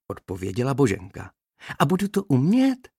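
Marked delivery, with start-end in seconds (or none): 1.8-2.9 high, anxious small voice; worried little bee. A narrator's reading. Young bee doubts she can fly, anxious and high. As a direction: ask it fearful and higher. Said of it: "obávala se jiná včelička".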